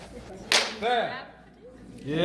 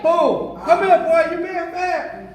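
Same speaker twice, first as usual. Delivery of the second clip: grandma scolding; 8 semitones higher, slower than usual. Retold or imitated. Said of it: "My mom and my grandma used to do that".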